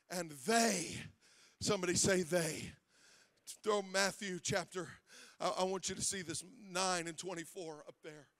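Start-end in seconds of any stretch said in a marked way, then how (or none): none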